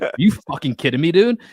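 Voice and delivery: high, loud retold outburst; losing it. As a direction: snap loudly, replaying your outrage. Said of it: "that's when I fucking lost it".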